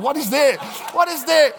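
high, aghast double question; mock horrified; shocked. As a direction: recoil at strange food. Horrified at first porridge.

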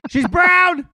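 high, loud exasperated shout; yelling across the room. Retold or imitated. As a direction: call it out across a room, voice jumping way up. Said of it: "yelling across from the".

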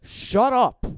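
high, loud outburst; angry shout. Real, not acted. Host silencing co-host mid-quarrel, angry shout. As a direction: snap it at full volume.

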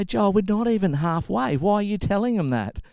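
high-pitched, incredulous telling-off; mock parental protest. Retold parent protesting to son.

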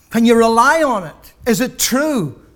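pulpit-pounding sermon climax; full-force preaching. Real, not acted. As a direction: preach it out with rising fire to the congregation.

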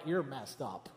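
soft teasing laugh; laughing tease. Retold or imitated. Family laughing at the niece's new accent. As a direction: tease her through laughter. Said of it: "We're like".

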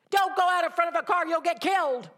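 put-on high, loud, fast warning yelled at a child; a frantic parent shouting at a child near the street; panicked parent shout. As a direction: yell it like a parent scared for the child.

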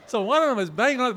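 ordinary talk, nothing marked

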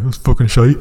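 a rough attempt at a Scottish accent. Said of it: "I can't do the Scottish accent".

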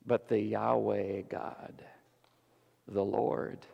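hushed reverent whisper, slow. Real, not acted. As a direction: breathe the name softly.

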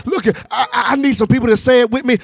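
driving, rousing sermon delivery; full-force preaching. Real, not acted. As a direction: preach it hard and urge them to respond.

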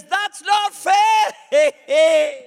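high, slow, very loud protest; screaming devil. Acted. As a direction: wail it like a cheated devil. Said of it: "The devil screams at the referee".

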